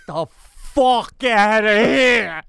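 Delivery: slow, loud drawn-out yell; mock blow-up. Imitating him finally snapping at photo seekers: slow loud yell. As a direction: let the patience snap in one big yell. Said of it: "and you go".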